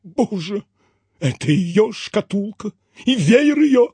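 high, tearful recognition; anguished, rising theatrical sob; sobbing exclamation. A narrator's reading. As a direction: cry out brokenly over keepsakes. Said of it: "всхлипнул".

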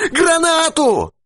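frantic high shout. A narrator's reading. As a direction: yell for the grenade in panic. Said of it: "кричал Дауги".

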